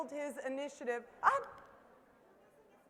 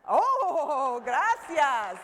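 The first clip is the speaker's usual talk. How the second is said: laughing thanks; 8 dB louder, much slower than usual. Real, not acted. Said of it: "laughing thanks after greeting".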